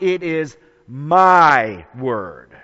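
slow and loud, "my" drawn out; emphatic MY. Acted. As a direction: declare it slowly, hammering "my". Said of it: "He's emphatic about".

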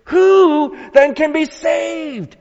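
high, loud, slow cry of dismay; dismayed shout. Acted. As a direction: shout it in acted despair. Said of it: "the disciples' dismay that brought forth the question".

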